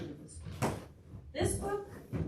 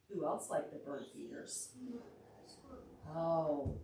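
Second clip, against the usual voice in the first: usual speaking voice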